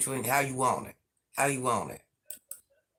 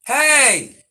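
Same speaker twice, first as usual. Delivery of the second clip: loud, high "Hey!"; one long, high holler; sharp shout; 12 semitones higher, 13 dB louder than usual. Real, not acted. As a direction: yell out to stop them in the back. Calling out sharply to the back.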